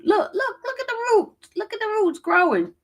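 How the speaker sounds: high, squealing look at the roots; excited. Real, not acted. Showing gray roots, laughing.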